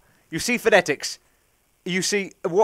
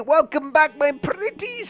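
witch greeting; 10 semitones higher, slower than usual. Pretend. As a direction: greet them like a wicked witch.

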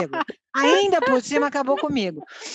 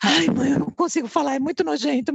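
higher-pitched, hammed-up kid's reluctance; dramatic child; 5 semitones higher than usual. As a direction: imitate a son dramatizing his embarrassment. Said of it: "Faz um drama". (imitando filho dramático)

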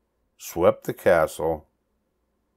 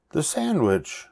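begging; 8 semitones higher than usual. A narrator's reading. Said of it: "begged Pinkerton".

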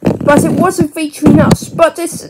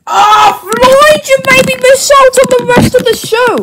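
annoyed shout; 7 semitones higher, 3 dB louder than usual. Pretend. Scolding toy for missed show.

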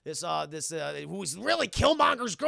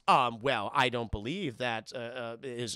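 lower, halting polite objection; hesitant exhibitor; 7 semitones lower, slower than usual. Retold or imitated. Museum exhibitor doubting Killmonger, low hesitant objection. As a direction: lightly play a doubtful museum expert.

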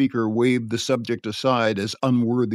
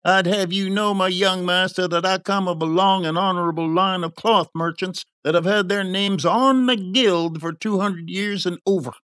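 higher-pitched indignant pride; affronted merchant; 8 semitones higher than usual. A narrator's reading. Merchant boasting of his lineage to youth, affronted. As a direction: put the youngster in his place, offended.

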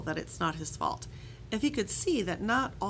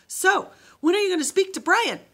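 much higher, louder nagging; pestering voice; 12 semitones higher, 10 dB louder than usual. Acted. Ghost dog nagging Joe about speaking to Brian, high and loud. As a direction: push him for an answer.

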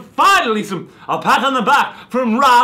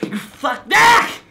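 shouting; 10 semitones higher, 4 dB louder, slower than usual. Acted. Raging at pumpkin.

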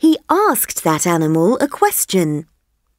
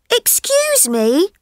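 higher, eager little elephant voice; curious elephant child; 13 semitones higher than usual. Acted. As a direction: ask like a wide-eyed young elephant. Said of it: "said the elephant's child".

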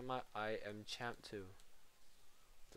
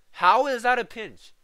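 yelled, much higher and louder; frustrated shout; 13 semitones higher, 21 dB louder than usual. Real, not acted. Streamer yelling at a bad pinch, frustrated shout. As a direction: explode at the bug in disbelief. Furious at game bug.

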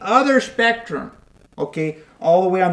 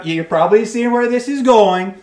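ordinary delivery

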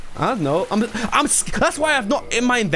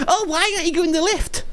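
high, put-on viewer voice; whiny-questioner parody, pitched way up; mocking backseater; 10 semitones higher than usual.

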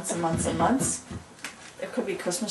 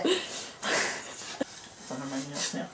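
whispered; 7 semitones lower, 12 dB quieter, slower than usual. Real, not acted. Whispered aside.

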